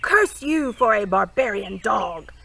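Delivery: loud, slow, furious splutter; spluttering rage. A narrator's reading. Prince in chains splutters at the king, enraged. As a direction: spit the words out in fury. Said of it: "spluttered Rabbit Ash".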